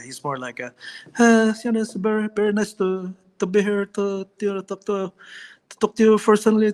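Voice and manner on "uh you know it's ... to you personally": gentle, shy cousin who wants to hang out; Manny Pacquiao impression. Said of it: "Manny is more subtle like that".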